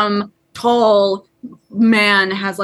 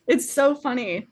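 ordinary delivery

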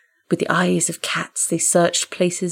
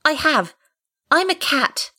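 very high kitten voice; baby animal; 10 semitones higher than usual. Acted. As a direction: squeak it like a young kitten. Kitten answers great cat.

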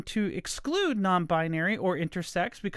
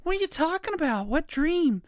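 high, fast, baffled questions; a listener's put-on outrage; mock confused listener; 8 semitones higher than usual. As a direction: play an indignant listener who doesn't get it. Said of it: "I'm like".

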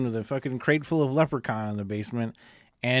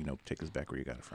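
hushed quick aside; 12 semitones lower, 17 dB quieter, faster than usual. Retold or imitated. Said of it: "he should have been like".

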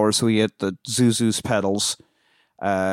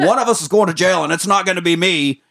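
mock angry threat; 6 semitones higher, 3 dB louder than usual.

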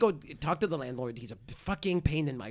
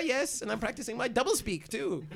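higher, nervously bright cover-up; 8 semitones higher than usual. Acted. Covering the two-Chris lie to the landlord, higher and nervous. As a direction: sell the lie a bit too eagerly.

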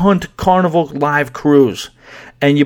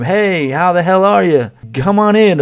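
imitated crowd scream; 6 semitones higher, 3 dB louder than usual. Piano bar crowd hollering at whoever walks in, mock scream. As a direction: call it out like a rowdy room greeting a newcomer. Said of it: "everybody was instructed to scream".

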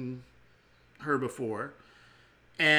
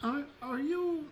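put-on old woman voice; 7 semitones higher, 8 dB quieter, slower than usual. GM plays Grimagrak the old woman.

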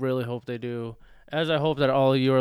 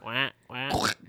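quacking like a duck; 3 semitones lower, 5 dB quieter than usual. Pretend. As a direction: do your best duck quack. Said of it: "can you sound like a duck?".

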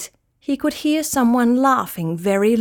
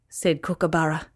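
ordinary delivery

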